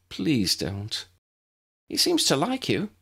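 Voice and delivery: pleading. A narrator's reading. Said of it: "Tessa pleaded".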